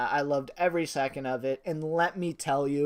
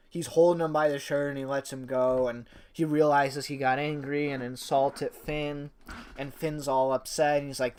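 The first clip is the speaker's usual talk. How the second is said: normal talking voice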